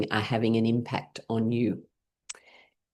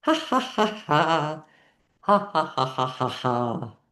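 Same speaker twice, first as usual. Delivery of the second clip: forced laughter. Shown as a demonstration. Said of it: "we're gonna do it with laughing".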